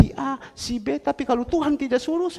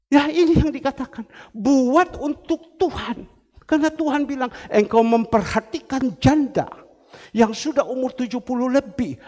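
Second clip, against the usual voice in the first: raised, emphatic sermon voice; forceful preaching; 3 semitones higher, 6 dB louder than usual. Real, not acted. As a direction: press God's charge on the congregation.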